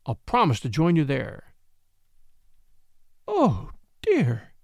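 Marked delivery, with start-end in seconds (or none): 3.3-4.5 a mild moan, lightly raised; understated moan of dismay. Acted. Frightened woman on the beam, a mild moan. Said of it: "she moaned".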